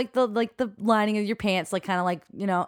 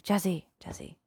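soft wake-up call; 6 dB quieter than usual. Retold or imitated. Waking him gently.

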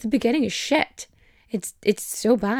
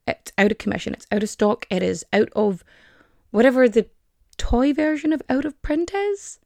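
normal talking voice

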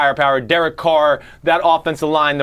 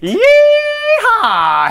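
cowboy yeehaw; 5 dB louder than usual. Acted. Cowboy shout opening segment.

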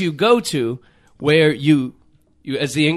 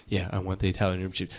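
low, much quieter mock customer reply; quiet, lower imitation of a customer; picky customer; 14 semitones lower, 13 dB quieter, faster than usual. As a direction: mumble the order like a fussy customer. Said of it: "this guy".

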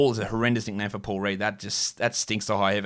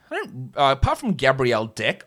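ordinary talk, nothing marked

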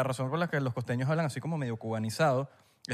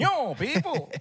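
mock Cuban exclamation; 8 semitones higher, much slower than usual.

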